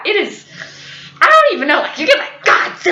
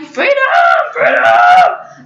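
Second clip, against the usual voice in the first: gleeful cheer; 10 semitones higher, 6 dB louder, much slower than usual. Retold or imitated. Celebrating roommate's absence.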